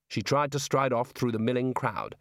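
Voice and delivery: plain reading voice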